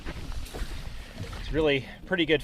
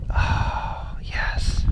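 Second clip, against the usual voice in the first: whispered delight. Real, not acted. Angler savouring the biggest fish yet, whispered delight. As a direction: breath-only whisper of triumph.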